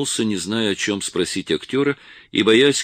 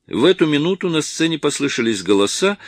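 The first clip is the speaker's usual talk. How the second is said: ordinary delivery, nothing marked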